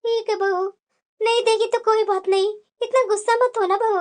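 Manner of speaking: calm the cross daughter-in-law, resigned; elderly mother-in-law placating. Acted.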